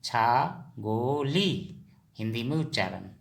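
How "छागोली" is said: छागोली drawn out very slowly, each syllable clear; slow drilled word. Shown as a demonstration. Teacher repeats छागोली for pupils, very slow. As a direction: stretch छागोली out for learners to copy. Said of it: "क्या कहेंगे?".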